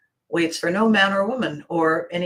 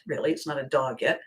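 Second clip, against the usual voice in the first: ordinary delivery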